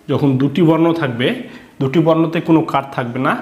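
ordinary talk

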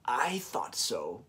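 put-on soft, slow, smiling old woman; knowing smile. Old woman to Camilla, soft and slow with a smile. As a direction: say it slowly with a smile. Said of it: "the old woman said with a smile".

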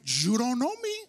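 street-smart Miami twang on the line; Miami accent. Shown as a demonstration. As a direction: say it like you're from Miami, cool and swaggering. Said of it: "say it like you from Miami".

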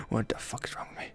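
embarrassed whisper to himself. Real, not acted. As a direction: mutter it under your breath, ashamed. Embarrassed after a joke.

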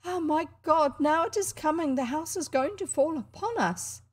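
high, loud frightened cry; trembling cry. A narrator's reading. Grandmother startled by hammering on the wall, frightened cry. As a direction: cry out in alarm at the blows. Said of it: "cried in a trembling voice".

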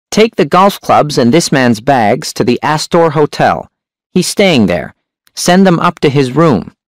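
high, sharp hunchback voice. A narrator's reading. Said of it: "The hunchback's voice was sharp and high".

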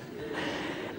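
quiet breathy chuckle; soft laugh. Real, not acted. Laughs at own joke.

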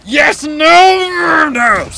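high, long-drawn yell; dismayed shout. Real, not acted. Player's win going wrong, dismayed shout. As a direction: stretch the no into a shout.

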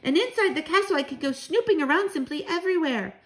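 put-on high, bouncy child's voice; excited cry. A narrator's reading. Mouse grandchild planning to snoop, excited cry. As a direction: rattle off the plan in a high pipe. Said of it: "I cried".

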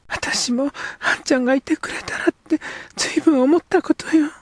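put-on high young girl's voice, wistful; a girl longing for her friend. Girl character speaks.

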